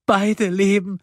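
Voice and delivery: high, anguished plea; sobbing. A narrator's reading. Woman pleading for both girls, sobbing. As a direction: beg through tears. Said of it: "schluchzte Silje".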